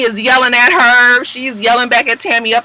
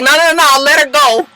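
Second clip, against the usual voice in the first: mock command; 6 semitones higher, 5 dB louder than usual. Jackie stopping someone.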